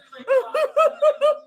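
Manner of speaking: shrill, loud mock cackle; mocking high cackle. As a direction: ham up her laugh, high and loud. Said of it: "this is you laughing at everything".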